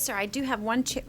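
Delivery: ordinary delivery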